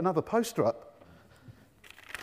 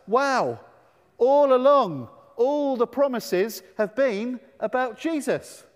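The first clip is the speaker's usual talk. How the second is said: excited revelation; 5 semitones higher, 4 dB louder, slower than usual. Acted. Excited realisation for the children.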